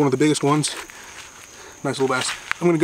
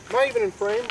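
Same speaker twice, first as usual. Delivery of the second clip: excited voice cracking into falsetto. Real, not acted. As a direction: voice cracks way up high. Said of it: "Voice crack".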